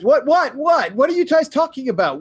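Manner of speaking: high, loud feigned innocence; playing dumb. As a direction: act like you have no idea what happened. Character plays dumb to attackers.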